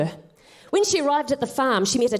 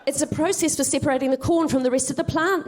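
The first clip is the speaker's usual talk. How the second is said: usual speaking voice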